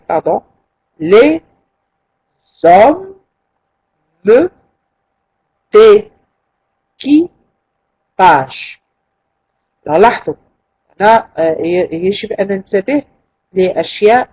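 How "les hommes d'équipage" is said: d'équipage drawn out very slowly; teacher stretching the verse word by word; very slow syllables. Shown as a demonstration. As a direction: drag out every syllable of d'équipage. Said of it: "كيف نقطع des syllabes".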